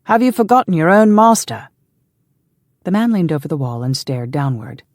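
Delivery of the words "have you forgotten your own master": loud, high challenge to a servant; shouting up. A narrator's reading. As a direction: call up to the wall in a raised voice. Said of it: "Stephen shouted up".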